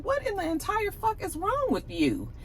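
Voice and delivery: very high mock-indignant quote; high-pitched, hammed-up disbelief; indignant imitation. Acted reaction to being slapped.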